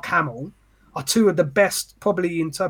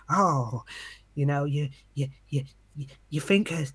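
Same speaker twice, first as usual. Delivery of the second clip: bad Joker impression; 2 semitones lower, 4 dB quieter than usual. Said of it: "a piss take impression of the Joker".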